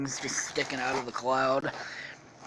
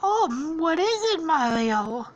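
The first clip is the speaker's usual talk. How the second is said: high, cooing cartoon princess; 13 semitones higher, 4 dB louder, slower than usual. Princess answering her suitor, high falsetto. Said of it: "and then she's like".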